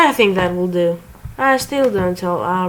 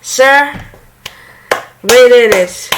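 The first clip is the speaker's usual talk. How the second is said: triumphant shout; 4 semitones higher, 7 dB louder than usual. Acted. Villain reports triumph to boss.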